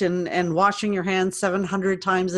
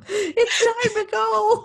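very high, drawn-out mock wail; screaming chipmunk; 13 semitones higher, much slower than usual. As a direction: play along as a squealing chipmunk.

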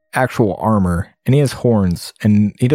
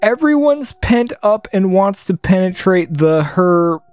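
pitched up high in disbelief; incredulous rereading; 8 semitones higher than usual. Real, not acted. Gamer rereads a crude game line, pitched up in disbelief. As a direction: reread the line as if you can't believe it. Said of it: "Why does it say that?".

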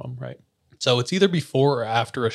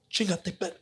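Spanish curse; 7 semitones higher, 8 dB quieter than usual. Retold or imitated. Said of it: "And in Spanish, he's like".

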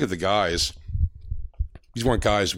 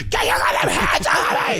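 high, slow, strained imitation scream; yelling like the freaking-out kid video; mock freakout; 11 semitones higher than usual. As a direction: ham up a viral tantrum. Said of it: "like, Fat Kid Freaking Out thing".

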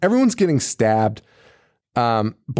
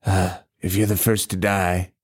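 lightly voiced creepy Wendigo; 7 semitones lower, 5 dB quieter than usual. Podcaster voicing the Wendigo to his captive, low and sinister. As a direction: croon it like a hungry monster. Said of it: "is like".

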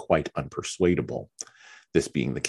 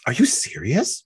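eager; 10 semitones higher, 5 dB louder than usual. Acted. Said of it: "her eyes brightening".

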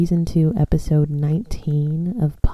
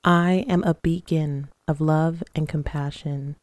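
guided meditation. Acted. Leads guided breathing and affirmations.

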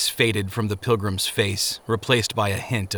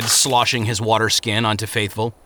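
plain reading voice

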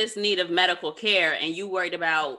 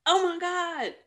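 much higher oh my God in real shock; stunned disbelief; 11 semitones higher, slower than usual. Real, not acted. Host hears the breathalyzer detail, stunned and high. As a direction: gasp it out in disbelief.

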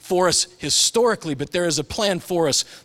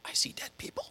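whispered movie line; 12 dB quieter than usual. Retold or imitated. Quoting the movie boy to the congregation, hushed whisper. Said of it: "That moment where you're like".

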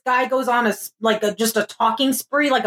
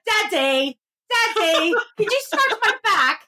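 drawn-out wheedling plea to daddy; obnoxious whiny kid; 7 semitones higher, slower than usual. Retold or imitated. Daughter nagging dad to scratch her back, obnoxiously whiny. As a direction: nag sweetly to annoy him. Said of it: "just say it really obnoxiously".

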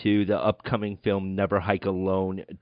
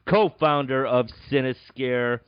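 normal talking voice

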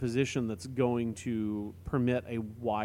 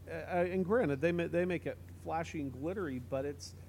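ordinary talk, nothing marked

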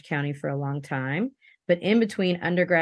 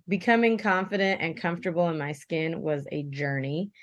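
ordinary talk

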